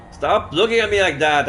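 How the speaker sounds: loud, high furious shout; angry shout. Acted. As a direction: yell it in her face. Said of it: "I shouted my rage".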